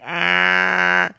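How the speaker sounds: mimicking the kid showing a tooth in her teeth; mock open-mouthed ah. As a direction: show the tooth with a gaping ah.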